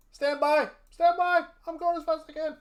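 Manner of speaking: high, loud flustered calling out; hurry, searching in a fluster; frantic. Real, not acted.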